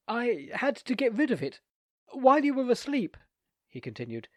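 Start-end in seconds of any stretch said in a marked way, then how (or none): 0.1-3.1 flustered confession. A narrator's reading. Embarrassed confession to lady.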